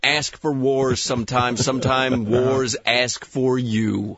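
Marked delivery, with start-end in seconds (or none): 1.6-4.2 movie trailer voice. Acted. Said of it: "make it sound like a movie trailer".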